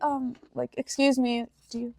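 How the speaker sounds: soft, hesitant mock request; timid and nervous. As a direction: ask timidly, barely speaking up. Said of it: "timid and, like, nervous".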